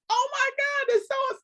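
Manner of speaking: squealed high "Oh my God", cut off mid-thought; stunned disbelief. Retold or imitated. Telling her friend about recognizing the lady, squealing in disbelief. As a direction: gasp it out in shock, voice jumping way up.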